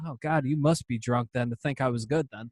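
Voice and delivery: ordinary talk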